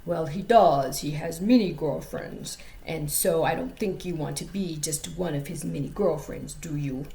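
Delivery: badly faked African accent, low. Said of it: "this is my African dude voice".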